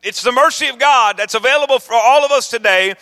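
loud, high, driving sermon build; preaching at full force. Acted. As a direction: preach it out to the congregation. Preaching climax, rising intensity.

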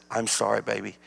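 soft, low and gentle; tender apology. Retold or imitated. Humble grandpa apologizing to grandchild in his arms, tender. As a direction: drop down low and soothe the child.

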